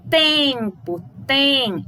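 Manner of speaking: tempo, tem with the em pushed through the nose; nasal em sound. Shown as a demonstration. Teacher showing the nasal em to pupils. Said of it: "esse tem que a gente fala, que vem no nariz".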